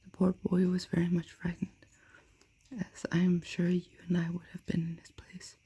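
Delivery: usual speaking voice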